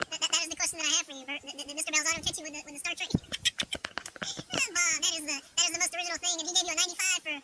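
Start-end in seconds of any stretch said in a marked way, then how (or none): 3.1-4.7 squeaky burst of laughter; high squealing laugh. Real, not acted. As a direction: squeal with delight. Laughing at teacher joke.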